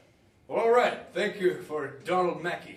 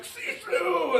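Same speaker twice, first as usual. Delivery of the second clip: high shouted cheer from the trackside; mock cheer; 14 semitones higher than usual. Spectator urging a runner on the track, high yell. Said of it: "They're like".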